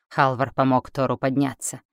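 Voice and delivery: plain reading voice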